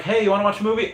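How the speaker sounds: light high-pitched partner voice asking sweetly; imitating partner. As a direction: mimic a partner inviting you to a movie. Partner voiced asking for movie night.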